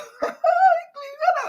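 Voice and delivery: high-pitched mocking cackle; gleeful high cackle; imitated laughter. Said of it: "He laugh, he laugh".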